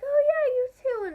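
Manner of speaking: high and loud cheerful reply; bright happy reply. Retold or imitated. Bella greeting an excited fan, high and bright.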